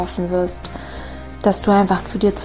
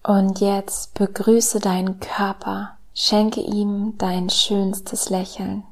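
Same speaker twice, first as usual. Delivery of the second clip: slow, soft meditation voice with whispered lines; calm guided meditation, hushed and slow; soft spa whisper; 3 dB quieter, slower than usual. Acted.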